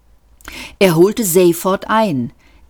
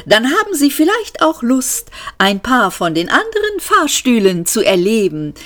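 eager, sing-song machine host, often pitched high; amiable talking lift; 6 semitones higher, 4 dB louder than usual. A narrator's reading. Talking lift reciting its welcome speech to passengers, amiable. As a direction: swoop up and down with relentless friendliness.

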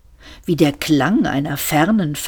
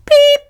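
short high imitated beep; 8 dB louder than usual. A narrator's reading. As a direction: squeak the beep out loud. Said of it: "ein kurzer Piepton".

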